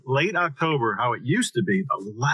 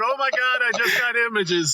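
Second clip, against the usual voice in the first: laughing mimicry; 7 semitones higher than usual. Host laughingly echoing the guest's exclamation. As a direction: repeat her words through a laugh. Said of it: "the name of this episode".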